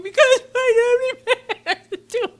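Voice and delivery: half-laugh, half-sob, high and loud; laugh-cry the excuse out slowly; laughing through tears. Retold or imitated. Retold tearful answer at the airport.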